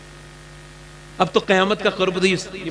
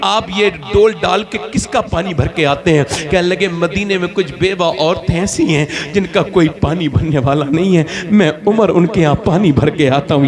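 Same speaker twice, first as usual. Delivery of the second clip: loud, driving sermon storytelling; loud pulpit narration; forceful preaching; 6 dB louder than usual. A narrator's reading. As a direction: project the tale to a congregation.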